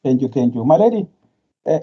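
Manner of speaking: ordinary talk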